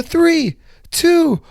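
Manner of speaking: high, loud counting; countdown call. Retold or imitated. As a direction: shout the count like the operator. Operator counts down backward jump.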